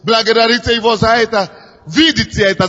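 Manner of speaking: ordinary talk, nothing marked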